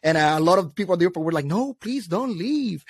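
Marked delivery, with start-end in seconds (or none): 1.5-2.8 drawn-out, higher mock plea; imitated pleading. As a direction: beg slowly, acting out a worried crowd. Acts out airport crowd pleading.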